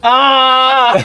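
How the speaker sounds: high mocking crowd jeer "Ah!". Fans react to Scottish retort.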